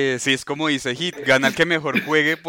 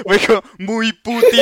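laughing; 6 semitones higher, slower than usual. Real, not acted. Laughs at own joke.

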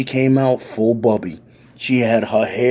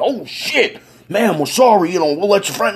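higher, flustered cop apology; startled cop; 9 semitones higher than usual. Retold or imitated. Cop apologises to the old lady, flustered and high. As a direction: backpedal like a scared cop. Cops backpedal.

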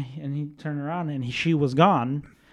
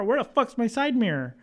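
higher-pitched baffled car owner; mock bewildered outrage, pitched up; baffled exclamation; 9 semitones higher than usual. Car owner discovering the missing mirror, baffled.

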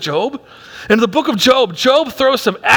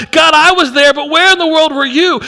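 raised, pleading cry to God; anguished cry; 6 semitones higher, 5 dB louder than usual. Retold or imitated. Pastor voicing the writer's complaint to God, raised anguished cry. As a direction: cry it out loud like a wounded believer.

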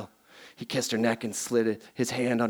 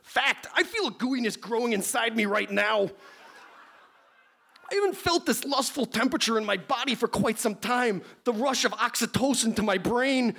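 high, hammy aroused professor; overheated nerd, played for laughs; lusty scientist voice; 9 semitones higher than usual. Plays Beekman aroused.

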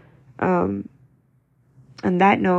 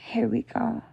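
dreading the next shift at home; weary whisper; 6 dB quieter than usual. Retold or imitated. Mother bracing to go home, tired whisper. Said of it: "I'm like".

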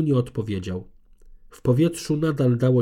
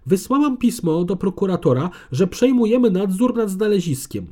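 higher, louder menacing warning; threatening; 8 semitones higher, 5 dB louder than usual. A narrator's reading. Conservator threatens Gabriel at the gate, loud and menacing. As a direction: threaten him from behind the gate. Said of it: "odezwała się groźnie".

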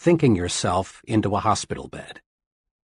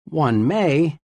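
"may" lifted a little and leaned on; stress on may; 3 semitones higher than usual. Shown as a demonstration. Author stressing a key word for readers, stress on "may". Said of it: "let me emphasize the word may".

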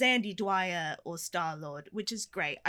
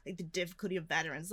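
softer, fast, self-important murmur; mock pious filmmakers; 4 semitones lower, 8 dB quieter than usual. Mocking the filmmakers' pitch, quick and softer. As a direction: rattle it off like a smug pitch. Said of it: "trying to be like".

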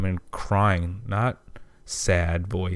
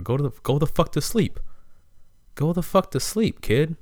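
put-on higher, fed-up rant at the kid; exasperated outburst; 7 semitones higher than usual. Telling the crying kid to sleep, exasperated. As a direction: throw your hands up in frustration.

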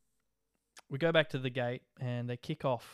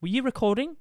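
sharp, much higher and louder challenge; Karen-style demand; 11 semitones higher, 8 dB louder than usual. Retold or imitated. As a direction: confront him like an aggrieved attendant. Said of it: "real Karen aggressiveness".

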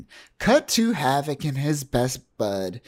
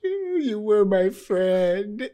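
mock grief; 7 semitones higher, slower than usual.

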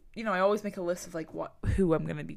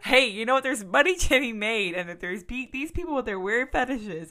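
tag; 6 semitones higher, 6 dB louder than usual. Retold or imitated. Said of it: "I was like".

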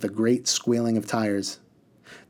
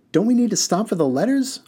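lightly voiced boy, much higher and questioning; curious boy asking; 11 semitones higher, 4 dB louder than usual. A narrator's reading. Haroon leans forward asking the driver. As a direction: ask it like an eager boy. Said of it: "leaned forward to inquire".

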